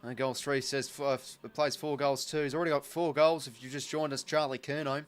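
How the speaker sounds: steady live footy call; play-by-play commentary. Acted. Streamer calling the footy match for viewers, play-by-play. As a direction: call the passage of play as it happens.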